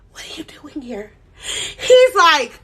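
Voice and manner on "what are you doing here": softer, whispered shock. Retold or imitated. Startled girl whispering to the man at her college door. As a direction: whisper it in disbelief. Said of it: "I'm over here shocked".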